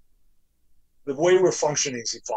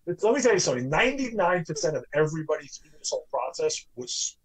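ordinary talk, nothing marked

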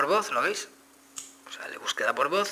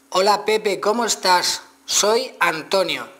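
higher and louder, slowly articulated for the phone; loud clear dictation; 7 semitones higher, 5 dB louder, much slower than usual. Shown as a demonstration. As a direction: speak up clearly to the voice search. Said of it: "no he vocalizado mejor bien".

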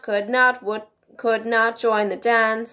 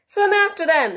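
high, loud storybook cry; an excited Gryphon's half-scream; mild scream; 9 semitones higher, 6 dB louder than usual. A narrator's reading. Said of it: "screamed the Gryphon".

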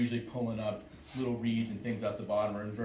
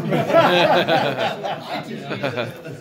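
laughing; 3 semitones higher, 11 dB louder than usual. Real, not acted. Laughing at own story.

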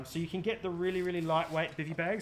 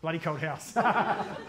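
slow echo; 7 dB louder, much slower than usual. Retold or imitated. Echoing another's answer.

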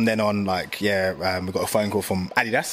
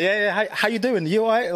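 high, put-on posh voice; mock posh greeting on the phone; 11 semitones higher than usual. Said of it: "Like, my posh voice".

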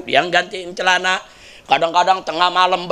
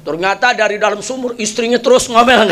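ordinary delivery

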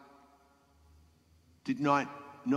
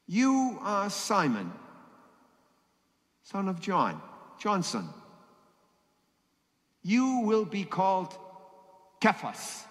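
Jesus speaking; 10 semitones higher, slower than usual. Acted. Jesus voiced in the reading.

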